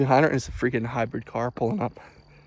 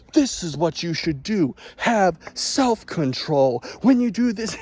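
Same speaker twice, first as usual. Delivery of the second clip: slow, raised mock guru voice; preachy fitness guru; 6 semitones higher, slower than usual. Vlogger mocking a shirtless fitness influencer, slow and raised. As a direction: lecture like a sweaty self-help guy. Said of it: "He's always talking out like".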